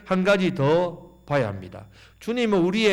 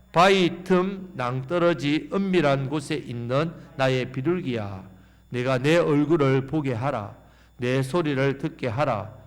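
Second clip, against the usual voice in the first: slow, lowered reading voice; scripture reading; 4 semitones lower, slower than usual. Acted. Preacher leading the church in reading, slow. Congregation reads Song of Songs.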